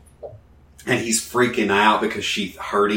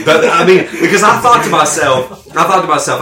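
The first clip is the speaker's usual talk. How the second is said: laughing; 8 semitones higher, 8 dB louder than usual. Real, not acted. Laughing through the story.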